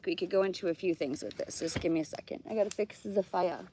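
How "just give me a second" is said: ordinary delivery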